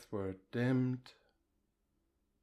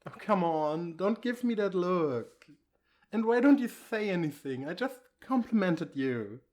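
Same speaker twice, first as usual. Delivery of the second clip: flustered protest; 7 semitones higher, 6 dB louder than usual. Acted. Flustered at partner's silence.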